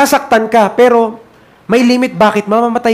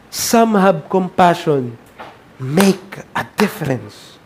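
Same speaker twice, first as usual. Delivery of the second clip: soft plea; 5 semitones lower, 6 dB quieter, slower than usual. Acted. Soft plea after hell preaching.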